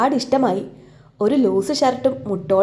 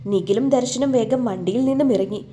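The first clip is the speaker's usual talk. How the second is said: usual speaking voice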